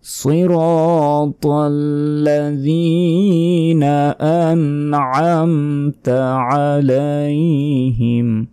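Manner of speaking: slow, high, drawn-out chant; tajweed recitation; Quran recitation. Shown as a demonstration. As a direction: recite it melodically with held vowels. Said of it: "recites verse again".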